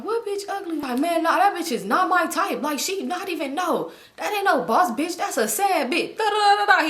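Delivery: mock him talking trash; retold trash talk. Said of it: "he just talking shit".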